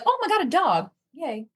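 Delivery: high, gushing squeal at a dog; excited squeal. Retold or imitated. Spotting a dog on the street, high excited squeal. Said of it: "I'm just like".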